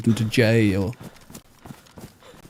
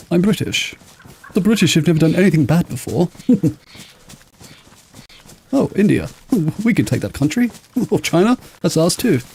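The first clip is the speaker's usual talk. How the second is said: mock English person; 6 semitones higher than usual. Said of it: "such a perfect English person".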